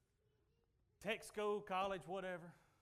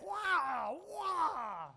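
loud high mock exhaust roar; engine noise; 6 semitones higher, 4 dB louder than usual. Preacher imitating his old truck's glass pack, loud rumble. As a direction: rev it like straight pipes.

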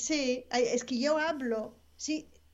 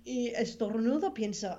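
usual speaking voice

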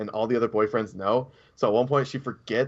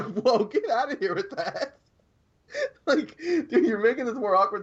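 high, slow, laughing brush-off; giggly mock disbelief; laughing dismissal; 10 semitones higher than usual. Girlfriend's retold dismissal, laughing.

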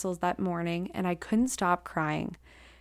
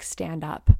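ordinary delivery, nothing marked

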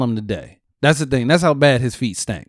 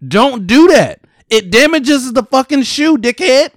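loud, higher scolding yell; exasperated imitated shout at customers; mock yell at kids; 8 semitones higher, 4 dB louder, much slower than usual. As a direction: yell at a kid wrecking the shoes. Yelling at kids ruining shoes.

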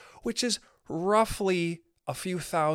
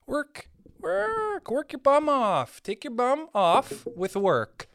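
mock rich boss; 8 semitones higher, slower than usual. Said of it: "they wanna tell you, like".

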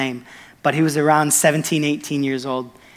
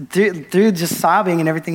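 ordinary talk

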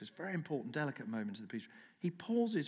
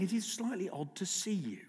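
"see" picked out with raised pitch; stress on SEE; 4 semitones higher than usual. Real, not acted. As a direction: lean on the word "see", pitched up. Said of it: "I put the emphasis on the word see".

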